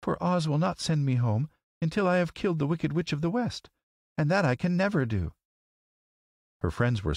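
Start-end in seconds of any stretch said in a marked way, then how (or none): 0.0-5.3 put-on high, plaintive little girl; mildly despairing girl's voice, pitched high; sad. A narrator's reading. Girl reporting the wizard's verdict to friends, sad. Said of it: "she said sadly".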